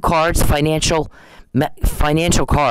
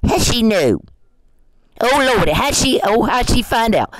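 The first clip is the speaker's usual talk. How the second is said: higher, mocking panicked whine; mocking whiny quote; 8 semitones higher than usual. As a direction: mimic the offender fretting, higher. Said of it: "when we quote you".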